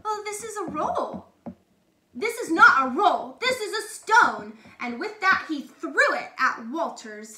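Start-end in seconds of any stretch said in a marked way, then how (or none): 0.0-1.3 meek baker voice. A narrator's reading. Baker meekly answers duke. 2.1-4.6 loud, higher mocking scorn; scornful duke. A narrator's reading.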